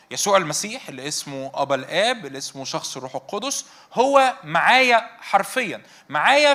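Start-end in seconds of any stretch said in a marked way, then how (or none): 3.9-6.5 slow, louder and higher, then a higher, louder echo of معايا; emphatic insistence. Acted. Preacher insisting to the congregation God is literally with them, emphatic. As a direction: hammer the point home, climbing on the repeat.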